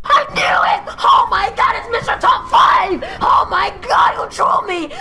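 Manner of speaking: real shouting at the prankster; real outraged yelling. Real, not acted. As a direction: yell it out, betrayed and furious. Pranked streamer finds out.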